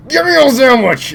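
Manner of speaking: high, loud goofy yell; comic high-pitched demand; mock demanding shout. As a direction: yell it high as a silly catchphrase. Shouted catchphrase during game kill.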